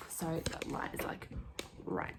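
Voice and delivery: hushed night whisper. Real, not acted. Kids asleep, whispering.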